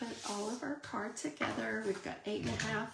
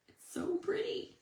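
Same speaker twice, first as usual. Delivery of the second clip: much higher, swooning over the set; gushing delight; 13 semitones higher than usual. Real, not acted. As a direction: coo it like you just fell in love with it.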